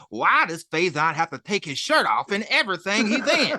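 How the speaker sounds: high, loud put-on comic's voice; hammed-up high incredulous rant; Cat Williams impression. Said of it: "I remember him saying".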